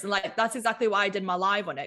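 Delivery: normal talking voice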